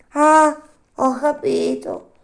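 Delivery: high, loud, drawn-out snail voice; slow snail. Acted. Snail at last recognising the puppet, slow snail voice. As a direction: let it dawn slowly, in a high snail voice.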